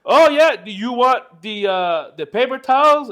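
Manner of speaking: drawn-out mock reply of the guy outside; slow stranger.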